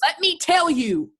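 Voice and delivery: high, drawn-out demand; angry insistence. Acted. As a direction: override him forcefully. Mother overrides son in quarrel.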